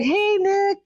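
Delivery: bright, high call to a star; greeting her idol eagerly; excited greeting. Retold or imitated. Fan greeting [name] eagerly, pitched high. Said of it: "I was like".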